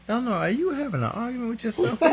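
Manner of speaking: high, quick, laughing question; laughing tease. Real, not acted. Host teasing Elnora about her muddled comment, laughing high.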